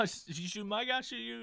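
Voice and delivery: high, hammed-up threat; a light comic standoff voice; mock threat. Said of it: "He's like".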